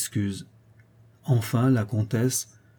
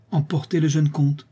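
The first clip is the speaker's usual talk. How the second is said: commanding; 5 semitones higher than usual. Acted. Countess orders servant.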